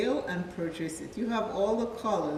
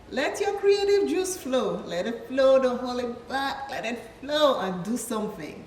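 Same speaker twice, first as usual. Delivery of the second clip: high and loud, urge them to let go; cheerleading her viewers to create; excited high cheer; 6 semitones higher, 7 dB louder than usual. Real, not acted. Hyping viewers up.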